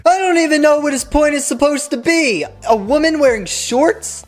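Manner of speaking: high, incredulous squeak of disbelief; exasperated disbelief. Real, not acted. Commentator reacting to the preacher, in high incredulous disbelief. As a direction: pitch way up in mock shock at the sermon.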